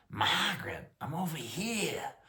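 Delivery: high-pitched mock holler in a Maine accent. Retelling the Mainer's call to his wife, Maine-accented mock holler. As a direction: imitate the Mainer's harsh call, without full volume. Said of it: "I'd been rattled by the".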